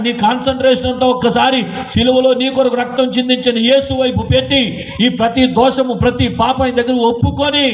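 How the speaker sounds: high, impassioned preacher's appeal; fervent preaching. Acted. Preacher urging the congregation to repent, high and fervent. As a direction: plead with the congregation from the pulpit.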